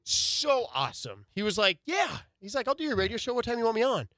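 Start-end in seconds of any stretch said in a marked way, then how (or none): 1.8-4.1 higher, light East Coast voice on the phone; higher pitched Brooklyn coach. Retold or imitated. Host imitating coach answering his phone, higher voiced. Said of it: "a little bit of a higher pitched voice".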